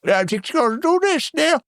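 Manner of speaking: lightly voiced confused old Aussie man; a poor attempt at an elderly Australian; mock Lenny voice. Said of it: "doesn't sound very Australian".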